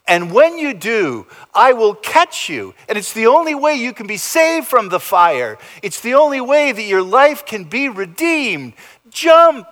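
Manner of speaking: high and loud, building to a cry; rising preaching shout. Real, not acted. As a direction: urge them to jump, full force.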